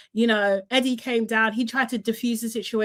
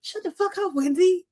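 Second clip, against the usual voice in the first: mock snap, pitched up high; imitating a snap; 8 semitones higher than usual. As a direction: replay the mum losing it, higher.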